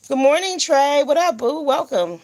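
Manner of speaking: cheerful live shout-out; upbeat greeting. Real, not acted. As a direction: warmly call out the welcome.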